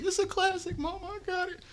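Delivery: high, put-on [name] voice; squeaky mock [name] impression; imitating [name]. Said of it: "he be like".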